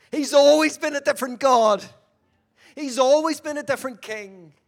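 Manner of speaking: loud, stress on always; proclaiming. Real, not acted. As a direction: ring out always like a preacher's cry. Preacher proclaims, peak of sermon.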